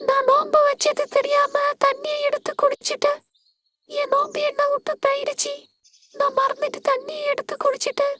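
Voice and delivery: put-on soft, tearful little boy. Boy confessing to his sister that he drank water, tearful and soft. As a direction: confess it through tears, quietly. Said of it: "ஏன் அப்துல்லா அழுவுற?".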